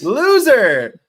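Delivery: very high, loud drawn-out taunt; high-pitched mock heckle; playful jeer. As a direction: hoot it mockingly at the losing team. Echoes the jeer, much higher.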